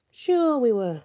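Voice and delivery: mock agreement; sarcastic. A narrator's reading. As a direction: roll your eyes at it. Nox scoffs.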